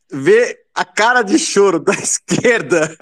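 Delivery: falando entre risadas; rindo. Real, not acted. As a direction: solte a risada no meio da frase. Rindo da esquerda.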